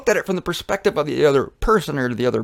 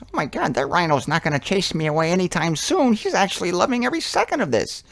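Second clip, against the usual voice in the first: lightly voiced amazed bird, climbing high; higher-pitched bird character, thrilled; tick bird; 3 semitones higher than usual. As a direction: marvel as the little bird, pitch rising. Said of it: "And the tick bird goes".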